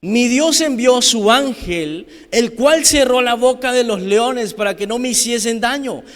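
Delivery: loud, high, drawn-out proclamation; full-force preaching. Acted. Preacher declares Daniel's answer over the congregation, building full-force shout.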